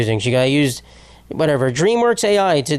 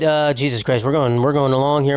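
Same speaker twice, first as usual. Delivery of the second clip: ordinary talk, nothing marked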